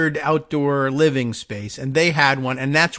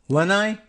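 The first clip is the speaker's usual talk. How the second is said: "Lenai" pitched higher, like a question; questioning pronunciation; 6 semitones higher than usual. Shown as a demonstration. Host unsure of the word, "Lenai" said questioningly. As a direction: try "Lenai" out high, as LEN-eye. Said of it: "the stress is on the first syllable".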